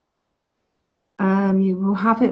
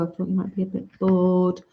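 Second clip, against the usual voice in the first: ordinary talk, nothing marked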